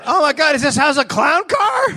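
high, shouted comic disbelief; hammed-up high-pitched dismay; mock exasperation. Acting exasperated stabber as more arrive.

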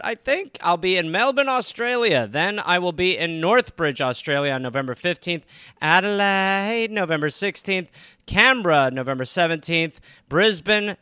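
upbeat tour announcement read; a lightly upbeat ad read; promo read. Acted. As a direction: sell the shows to the fans.

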